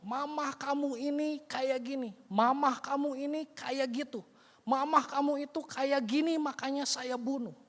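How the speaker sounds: high, mocking blaming voice; scornful killer. Prisoner sneers at the son, blaming his mother. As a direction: sneer out the excuses.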